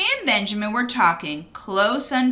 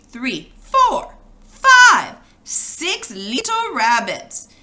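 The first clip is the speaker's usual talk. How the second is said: counting builds to a high loud cry on five; gleeful farmer counting; 4 semitones higher, 5 dB louder, much slower than usual. Acted. McGregor counting the bunnies into his sack, gleeful. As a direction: crow out each number as you catch them.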